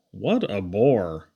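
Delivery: slow, low and unimpressed; drawn-out bored sigh of a line; bored deadpan. A narrator's reading. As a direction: let the punchline sag with boredom. Punchline deadpan.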